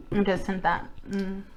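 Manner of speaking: ordinary delivery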